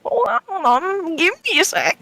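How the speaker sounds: put-on an old granny squinting at a letter; grandma voice. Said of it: "She's like".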